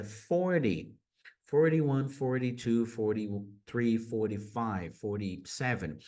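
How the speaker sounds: FORTY with the T tapped, "for-dee"; American flapped T in FORTY. Shown as a demonstration. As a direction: tap the T in every FORTY. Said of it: "mesma coisa".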